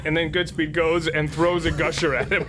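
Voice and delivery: excited, laughing. Real, not acted. Gleeful retelling of combo.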